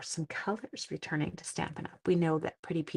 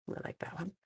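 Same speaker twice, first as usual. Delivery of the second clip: whisper; 14 dB quieter, much faster than usual. Real, not acted. Admiring paper to herself.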